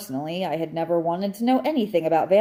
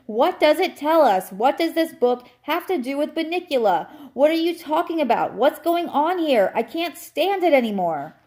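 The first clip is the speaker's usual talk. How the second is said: high-pitched exasperated rant; near-screaming outburst; 9 semitones higher, 4 dB louder than usual. A narrator's reading. Dog who can't stand it anymore, practically screaming. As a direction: pile up the questions at a near scream. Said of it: "I practically screamed".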